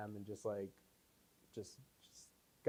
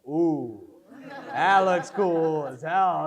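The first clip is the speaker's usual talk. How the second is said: loud, slow, delighted "cool as hell"; excited discovery; 4 semitones higher, 13 dB louder, much slower than usual. Retold or imitated. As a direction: savour the discovery, thrilled and loud. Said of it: "I go".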